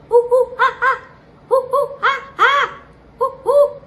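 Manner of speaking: high, loud hooting monkey with laughs; monkey noises. Acted. Playing the toy monkey for the child, high hoots and laughs. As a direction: hoot like a playful monkey. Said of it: "the monkey goes".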